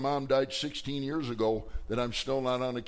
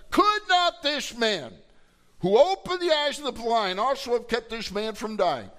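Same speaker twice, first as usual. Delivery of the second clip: high, loud, declaimed challenge; naysayers' challenge; 9 semitones higher, 7 dB louder than usual. Acted. Crowd doubting Jesus over Lazarus, loudly challenging. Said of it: "now you got the naysayers".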